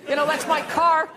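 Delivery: hammed-up neighborhood grump yelling; mock angry scolding. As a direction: confront a kid messing with your car. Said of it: "you gotta be like".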